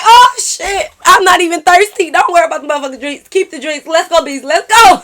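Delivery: high, loud exasperated outburst; acted desperate yelling; frantic urging. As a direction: hurry a friend out in a panic. Acting out frantic urge to leave.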